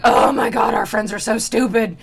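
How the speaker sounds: loud hammed-up groaning complaint; mock exasperation. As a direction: moan it like a fed-up cartoon friend. Acted demons exasperated at friends.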